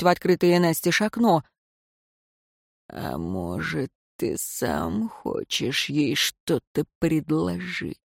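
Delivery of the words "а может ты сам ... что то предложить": much lower, slow, softer rasp; hoarse rasp. A narrator's reading. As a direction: rasp it out slowly, closing in. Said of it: "прохрипел Безликий".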